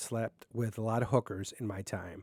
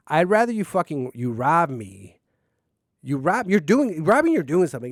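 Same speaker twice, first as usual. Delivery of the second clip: louder, higher, heated rant; angry rant; 5 semitones higher, 8 dB louder than usual. Real, not acted. Host ranting alone about beggars, angry and loud. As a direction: push it out like a worked-up rant. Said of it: "you just go into these angry rants".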